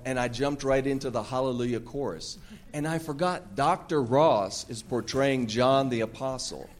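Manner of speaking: normal talking voice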